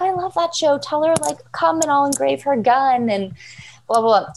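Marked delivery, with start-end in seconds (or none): 0.0-3.1 higher-pitched fan enthusiasm, lightly acted; excited engraver. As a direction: mimic a delighted engraver gushing. Engraver thrilled about the show.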